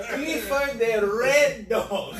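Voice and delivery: "red" stretched out with a hammed-up R, laughing; slow, high, loud mock mispronunciation of "red"; exaggerated R. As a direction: overdo the R in "red", booming and teasing. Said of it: "R's were supposed to be rolled".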